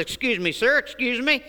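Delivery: lightly voiced eager evangelist. Evangelist stops stranger politely.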